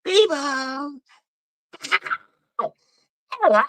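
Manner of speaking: bright, high welcome call to the arrivals. Real, not acted. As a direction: sing out a greeting across the room. Calling out to arriving viewers.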